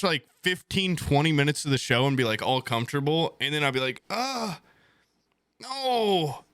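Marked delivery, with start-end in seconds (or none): 4.1-6.4 pitched-up groan of dread; high whiny groan. Retold or imitated. High whiny groan at having to get up and pee again. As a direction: whine it high like you can't face it.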